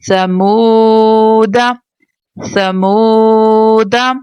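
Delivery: ला आऊं तुम drawn out slowly, syllable by syllable; slow Quran recitation. Shown as a demonstration. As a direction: recite each syllable slowly with long vowels. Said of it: "recitation demo of word".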